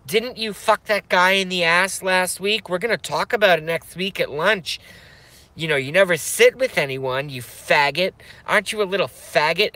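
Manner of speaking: high, mocking voice with a fake cackle; jeering bully. Imitating a teen bully jeering at him on the phone, high and mocking. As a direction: jeer and cackle like a cruel teen girl.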